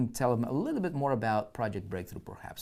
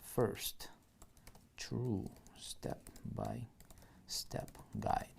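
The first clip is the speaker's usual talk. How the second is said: very slow whisper of the headline; whispered dictation; 2 semitones lower, 10 dB quieter, much slower than usual. Shown as a demonstration. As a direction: hush the headline out as you type it. Whispers headline while typing.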